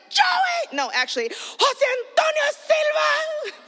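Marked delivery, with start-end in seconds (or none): 0.1-0.8 angry yell. Retold or imitated. Big sister yelling at her little brother. 1.3-3.6 full-name scolding. Retold or imitated. Sister yelling her brother's full name over the phone bill. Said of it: "the true Latin in me".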